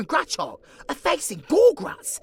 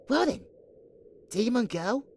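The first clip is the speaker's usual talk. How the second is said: normal talking voice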